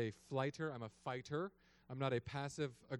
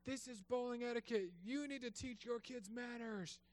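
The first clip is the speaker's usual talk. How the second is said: snooty lady; 11 semitones higher than usual. Retold or imitated. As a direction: lecture like an uptight stranger. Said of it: "this lady's".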